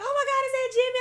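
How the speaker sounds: very high, loud mock squeal; mother's surprised squeal. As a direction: imitate a mom shrieking in recognition. Imitates mother's delighted surprise.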